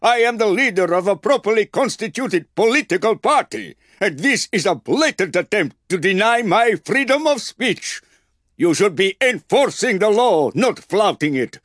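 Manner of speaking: a pompous senator protesting from behind the soldiers; outraged shout. A narrator's reading.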